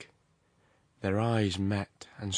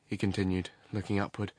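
ordinary delivery

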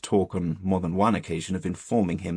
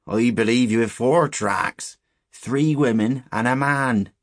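slow, loud rustic pronouncement; higher, drawn-out countryman's voice, emphatic; ominous old shepherd; 4 semitones higher, 7 dB louder, slower than usual. Acted. Shepherd telling boys whose tracks they are, ominous.

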